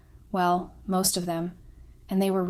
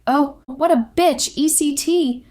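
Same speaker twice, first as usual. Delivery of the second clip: loud, high, drawn-out mock outcry; loud crowd jeering; 8 semitones higher, 8 dB louder, slower than usual. Guests react to the affair photos, loud mock jeering. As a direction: imitate scandalized onlookers calling out, pitched well up. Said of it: "Everyone was making sounds loudly like".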